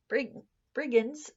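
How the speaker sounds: ordinary delivery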